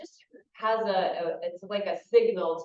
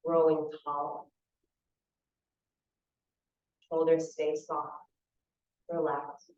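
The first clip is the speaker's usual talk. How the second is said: calm visualization in short, spaced phrases; unhurried meditation teacher, phrase by phrase; guided meditation. Real, not acted. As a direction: guide them through the image, leaving long silences.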